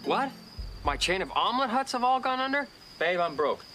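high-pitched put-on dismay; feigned shock. Schemer rehearses confessing he's broke, feigned shock. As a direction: act out discovering sudden ruin. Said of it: "that was pretty good. I believed it".

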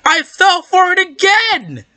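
very high, slowed squeal; high-pitched, drawn-out frustration; exasperated outcry. Real, not acted. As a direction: wail in disbelief at being fooled. Tricked by the same trap.

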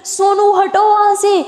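high, slow drawn-out yell of his name; frantic shout. Retold or imitated. Maya yelling to Sonu to get off the road, high slow frantic call. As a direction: call out to stop him before the truck hits. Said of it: "तभी माया चिल्लाती है".

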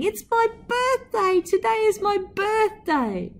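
put-on high, gleeful child's shout; excited child shouting. A narrator's reading. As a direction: call it out like a thrilled little girl.

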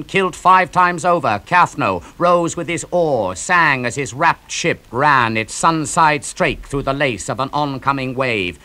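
ordinary delivery

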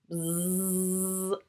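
quiet imitated buzzing; bee buzz. Shown as a demonstration. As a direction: buzz through the lips like a bee. Asking which bugs buzz.

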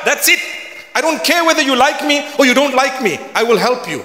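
rally the believers, pressing each line; preaching at full force. Acted. Preaching about the dog hadith.

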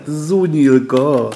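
silly made-up word. Pretend. Marvelling at an old machine, goofy drawn-out cartoon exclamation. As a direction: drawl it like a goofy cartoon character.

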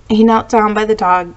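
usual speaking voice

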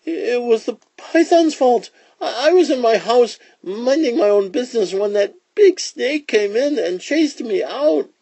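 very high, pleading little rabbit voice; timid rabbit. Acted. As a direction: blame someone else in a timid rabbit squeak. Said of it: "The timid little creature".

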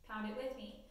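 ordinary talk, nothing marked

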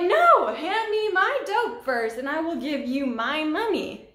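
a loud, high 'No!' then firm insistence; retold defiant refusal. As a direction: stand your ground against a threat. She refuses to pay first.

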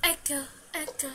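soft, dwindling echo imitation; fading echo. Player calling Ib, voice fading like an echo. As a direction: let each repeat die away.

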